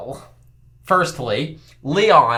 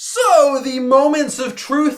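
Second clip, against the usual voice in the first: host it like a grand results reveal; mock game show host; 9 semitones higher than usual. Hosting a results segment.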